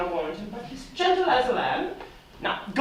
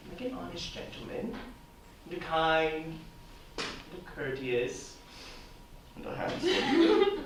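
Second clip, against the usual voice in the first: teasing drawl; 6 semitones lower, 9 dB quieter than usual. Acted. Nurse teasingly delays the news.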